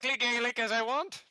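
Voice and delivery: fast, high, loud Gaelic brogue; generic Gaelic accent. Acted. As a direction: rattle it off in stage Irish. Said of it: "generically Gaelic".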